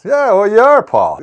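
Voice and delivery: loud, high scoff dropping on the name; mock disbelief. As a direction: call it out as if humoring a prankster. Retold disbelief at star on doorstep.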